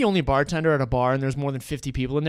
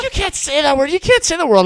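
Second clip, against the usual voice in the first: high, loud imitation of a girl; mock-outraged girl; 13 semitones higher, 10 dB louder than usual. Girl at the table scolds the bartender, mock-outraged. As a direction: pitch it up in mock protest. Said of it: "one of the girls at the table".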